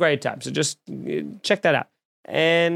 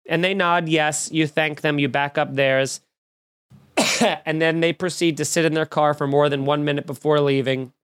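ordinary talk, nothing marked

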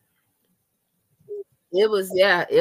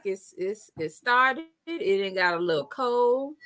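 usual speaking voice

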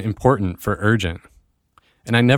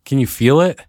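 higher, rising, excited question; buzzing with excitement; 4 semitones higher, 3 dB louder than usual. Real, not acted. Host proud of what the team created, buzzing with excitement. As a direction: ask it bursting with excitement. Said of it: "I'm just buzzing with excitement".

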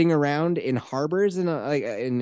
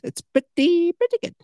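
"It's pretty, pretty good" jumped way up high and a bit louder; squeaky high repeat; 4 dB louder than usual. Shown as a demonstration. Host praising his own podcast in a high squeaky voice. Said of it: "Pretty good podcast".

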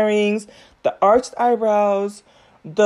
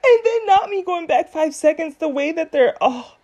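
after laughing hard; 7 semitones higher than usual. Real, not acted. Cracking up rewatching video.

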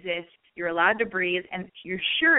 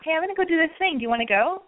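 breezy, offhand invite with a higher opening; totally nonchalant; 7 semitones higher, 3 dB louder, faster than usual. Shown as a demonstration. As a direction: toss out the invitation like it's no big deal. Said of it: "totally nonchalantly go".

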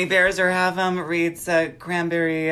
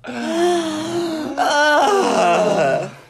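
dramatic gasp; 12 semitones higher, 6 dB louder than usual. Acted. After the theme song.

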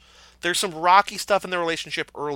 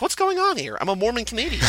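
mock Gosling; 8 semitones higher, faster than usual. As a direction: squeak it out like a baffled missionary. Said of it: "Gosling asks".

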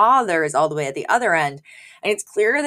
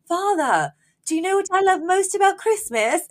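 high-pitched mock child calling across a room; young Maxwell; 13 semitones higher than usual. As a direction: imitate a boy calling to Father, much higher. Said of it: "he literally has to yell".

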